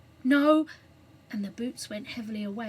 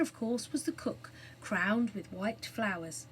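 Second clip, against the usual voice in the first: plain reading voice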